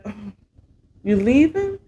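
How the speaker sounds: ordinary talk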